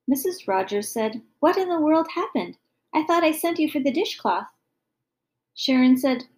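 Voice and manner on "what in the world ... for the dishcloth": mild exasperation, pitch lifted; exasperated mother. A narrator's reading. Mother finding daughter amid broken spice jars, mildly exasperated. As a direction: lightly scold in disbelief. Mother finds the kitchen wrecked.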